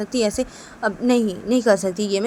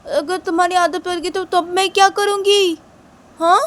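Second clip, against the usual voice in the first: scolding boss; 5 semitones higher, 4 dB louder than usual. Pretend. Boss refuses the maid.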